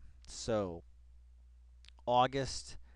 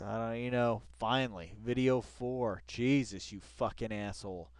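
usual speaking voice

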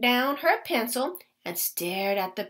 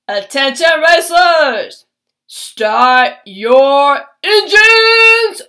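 big booming race announcer, much louder; boomy announcer; 11 dB louder, much slower than usual. A narrator's reading. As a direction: call the start across the field. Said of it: "the announcer's big, boomy voice".

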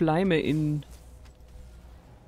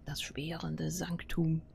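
whisper; 5 dB quieter, slower than usual. Real, not acted. Reading area name slowly.

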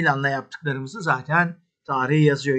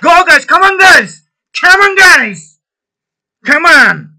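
very loud, high rally cry; pumping up the squad before kickoff, full shout; team talk shout; 10 semitones higher, 13 dB louder than usual. Pretend. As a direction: roar at the players in the locker room. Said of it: "Sahaya çıkın".

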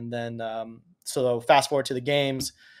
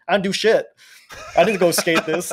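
embarrassed protest; 5 semitones higher, 7 dB louder than usual. Retold or imitated. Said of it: "I'm like".

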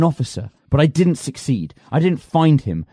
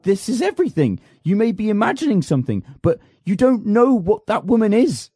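frantic pleading; 7 semitones higher than usual. Acted. Pleading with servant.

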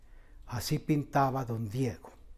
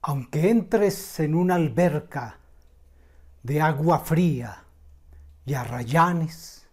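impassioned declamation, loud and higher on the swells; poetry recitation; 3 semitones higher, 10 dB louder than usual. Acted. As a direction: recite it grandly, line by line, with long dramatic pauses. Recites the poet's love poem.

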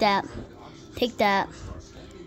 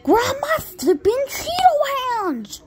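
high, slow drawn-out wail; exasperated squeal; 12 semitones higher, slower than usual. Pretend. Fed up repeating to a partner, high exasperated squeal. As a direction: wail each word slowly, way up high.

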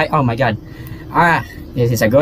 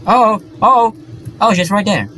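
startled fright; 9 semitones higher than usual. Real, not acted. Spots the girl.

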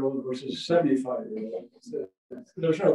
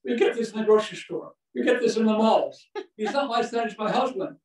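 imitating the wife; 7 semitones higher than usual. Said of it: "She said".

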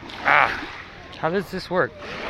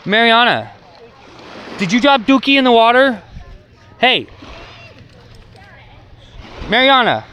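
calling from far away; 5 semitones higher than usual. Real, not acted. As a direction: call the dog from down the shore. Calling dog from a distance.

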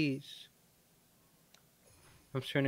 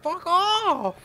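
high loud dismissive yell; angry shout; 9 semitones higher, 4 dB louder than usual. Real, not acted. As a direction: shove it away with a yell. Rejecting unwanted unit.

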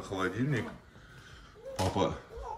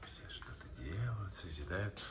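hushed whisper; 15 dB quieter than usual. Real, not acted. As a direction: whisper softly so as not to disturb. Whispering near drawing child.

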